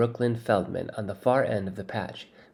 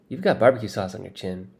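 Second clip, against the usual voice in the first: usual speaking voice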